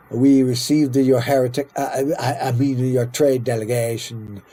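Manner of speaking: hammed-up bad Italian accent; muddled, badly faked Italian accent. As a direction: do a clumsy Italian accent for a visiting leader. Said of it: "A bad Italian accent for this guy".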